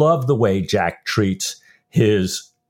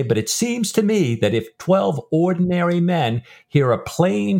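higher-pitched, earnest character speech; dramatized book reading; 5 semitones higher than usual. Acted. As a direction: read Jack's proud argument as dialogue from a novel.

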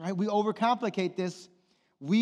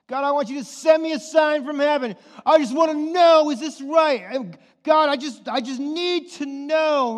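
higher, louder pleading; desperate plea; 8 semitones higher, 8 dB louder than usual. Pretend. Believer begging heaven for a sign, pleading loudly. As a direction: beg God for a sign. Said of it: "pleading for a sign".

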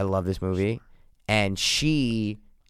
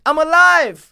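very high, loud cry of survival; loud, high-pitched film impression; Bridget von Hammersmark; 9 dB louder, slower than usual. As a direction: shout it high, like a shootout survivor. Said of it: "She's like".

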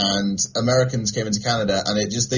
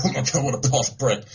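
mock sneering viewer; 3 semitones higher than usual. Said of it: "People right now ... are going".

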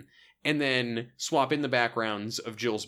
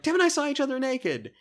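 high, fast confession; blurting it out; 12 semitones higher than usual. Retold or imitated. Nancy blurting out the secret, high pitched. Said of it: "she just blurts out".

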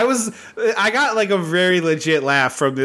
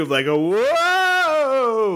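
mock whoa; 8 semitones higher, much slower than usual. Imitating a stunt fall.

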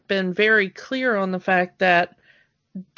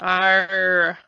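lower, gruff pirate "arr"; pirate growl; 5 semitones lower than usual. Pretend. Talk Like a Pirate month.

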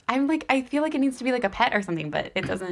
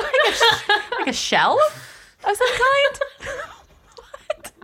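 laughing; 10 semitones higher, slower than usual. Real, not acted. Puzzled guessing, laughing.

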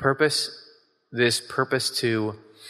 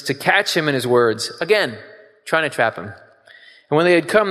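scripture reading; 5 dB louder than usual. Acted. Preacher reads scripture aloud.

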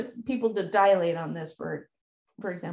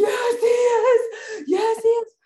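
high crying shout, much higher and louder; sobbing child yelling back at mom; hysterical child shout; 13 semitones higher, 8 dB louder than usual. Retold or imitated. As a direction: scream it through tears like a distraught child.

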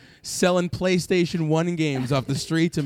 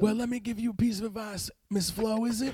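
Bill Clinton voice; 3 semitones higher, 7 dB quieter than usual. Acted. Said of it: "I'm losing the Bill Clinton voice".

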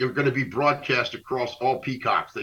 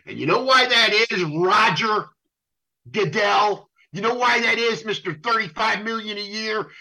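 sarcastic rant; 8 semitones higher than usual. Real, not acted. Addresses Goodell in a rant.

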